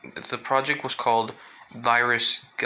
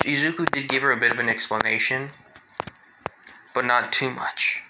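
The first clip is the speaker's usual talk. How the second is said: plain reading voice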